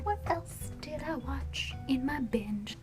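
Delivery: tipsy whispered aside; whispering. Real, not acted. Tipsy aside mid-review.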